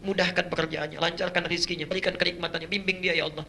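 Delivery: soft, hushed pleading prayer; mother's tearful prayer. Acted. Mother prays softly at night for her child. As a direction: plead quietly like a weeping mother.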